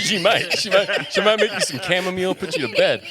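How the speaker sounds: laughing along at a friend's joke. Real, not acted. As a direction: giggle through it as you agree.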